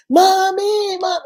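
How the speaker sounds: put-on children shouting for mommy when she comes home; imitating children calling. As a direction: call up the stairs like an eager child.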